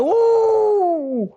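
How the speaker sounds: high, drawn-out crowd ooh at a knockout. Retold or imitated. Inmates watching a knockout punch, one high drawn-out ooh.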